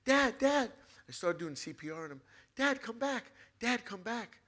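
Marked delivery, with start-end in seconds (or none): 0.0-0.7 loud, high-pitched pleading yell; desperate yell. Retold or imitated. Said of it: "And I yelled". 2.5-4.4 high, loud plea; desperate yelling. Retold or imitated. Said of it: "And I yelled".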